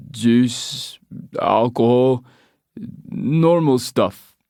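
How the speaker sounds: slow, loud pushy local; Scottish pub man. Acted. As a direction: lean in and drawl the question. Man lists drink contents.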